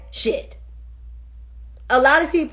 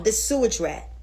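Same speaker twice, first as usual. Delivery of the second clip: usual speaking voice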